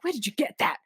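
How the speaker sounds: high, indignant mock outrage; pissed-off princess. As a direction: act out an angry princess, voice climbing. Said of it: "she's just pissed".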